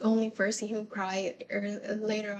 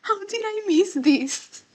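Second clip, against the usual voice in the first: high, loud stunned question; realizing something obvious, high and incredulous; shocked disbelief; 11 semitones higher, 6 dB louder than usual. Retold or imitated. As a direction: gasp it out in disbelief. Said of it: "I'm like".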